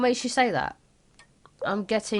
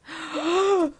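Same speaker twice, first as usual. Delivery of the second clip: shocked gasp; 7 semitones higher than usual. Acted. Mother reacts to son's confession.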